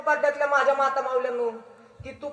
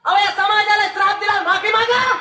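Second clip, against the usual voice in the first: higher, louder firm order; stern demand; 7 semitones higher, 4 dB louder than usual. Retold or imitated. Tukoba ordering his wife to apologise, stern. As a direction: insist she apologise, no room to argue. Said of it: "तुकोबारायांनी सांगितलं".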